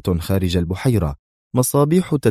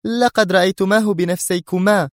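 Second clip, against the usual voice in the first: high, insistent cry; high-voiced emphatic protest; exclaiming; 11 semitones higher than usual. A narrator's reading. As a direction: exclaim it in a much raised pitch. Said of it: "هتفت".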